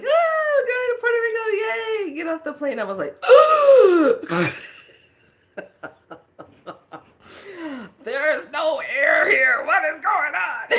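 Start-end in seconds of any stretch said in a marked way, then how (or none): 0.0-2.2 high, loud, gleeful squeal; loud high excited yay; excited cheer. Retold or imitated. Retelling excited arrival. 8.0-10.7 loud high mock panic; panicked shout. Retold shock at humidity.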